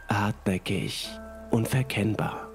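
plain reading voice

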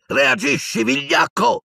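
high, furious shout; yelled insult. A narrator's reading. Harry goading Piton as a coward, yelling. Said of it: "gli urlò Harry".

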